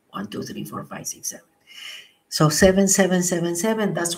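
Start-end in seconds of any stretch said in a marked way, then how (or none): 0.1-1.4 whispered count, breath held; holding breath. Shown as a demonstration. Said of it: "I don't breathe".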